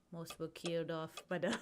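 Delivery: hint of French accent slipping out. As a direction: let the French creep in. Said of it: "keeps coming out with French".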